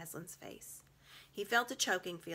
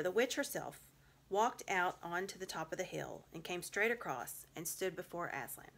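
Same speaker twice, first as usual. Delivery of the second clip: ordinary delivery, nothing marked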